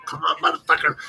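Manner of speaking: bait the charging dog with a high yell; taunting shout. Retold or imitated. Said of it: "I was like".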